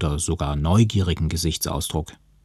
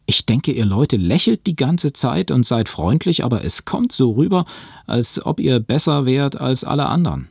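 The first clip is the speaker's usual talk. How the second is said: gereizt; 7 semitones higher, 3 dB louder than usual. Acted. Josh greift die Gruppe an.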